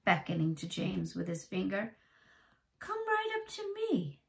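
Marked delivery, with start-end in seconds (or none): 2.8-4.2 put-on high thin coaxing old man; creepy old man. Old man luring little boy, high coaxing voice. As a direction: lure the boy closer, high and sly.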